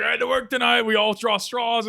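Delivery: high, loud mock complaint; griping cop. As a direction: imitate an officer moaning about his shift. Retold cop complains about working.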